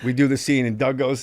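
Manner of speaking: ordinary talk, nothing marked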